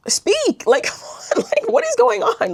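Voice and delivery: high, incredulous plea with a laugh; exasperated, voice shooting up into a squeak; laughing exasperation. Real, not acted. Woman fed up with a silent date, laughing in exasperation.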